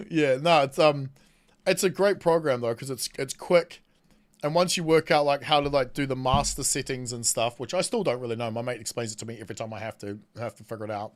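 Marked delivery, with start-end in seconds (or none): none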